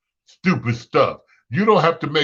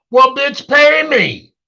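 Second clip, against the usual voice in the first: high, loud mock yell at the debtor; loud demand; 13 semitones higher, 7 dB louder, slower than usual. Comedian acting a lender yelling at a debtor to pay up. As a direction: shout the demand for payment.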